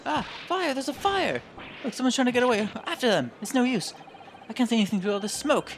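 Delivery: high, urgent acted cries of fire; panicked alarm. Someone spots fire in the courtroom, panicked shouting. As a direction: raise the alarm in a high, excited voice.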